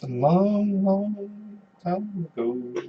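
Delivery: slow, drawn-out, high storyteller intonation; epic movie narrator. Pretend. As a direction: intone it like a film's opening. Spoofing Star Wars opening crawl.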